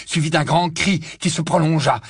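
ordinary delivery, nothing marked

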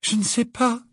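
high, understated dismay; overwhelmed Hermione. A narrator's reading. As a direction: answer helplessly in a high voice. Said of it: "répondit Hermione accablée".

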